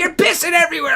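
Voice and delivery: high, loud shouted accusation; angry shout. Retold or imitated. Wife yelling at her drunk husband, high angry shout. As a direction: yell it in disgust. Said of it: "she's like".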